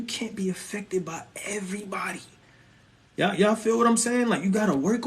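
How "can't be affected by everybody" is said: hushed whisper, softer at the end; a confiding whisper to the crowd. Real, not acted. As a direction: lean in and whisper it. Preacher drops to a whisper.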